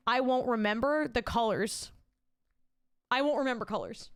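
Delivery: ordinary talk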